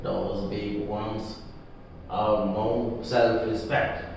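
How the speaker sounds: slow, soft and low with bitterness; bitter. A narrator's reading. Old bootmaker complaining about big firms, bitter. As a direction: spit out his contempt quietly. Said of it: "he spoke long and bitterly".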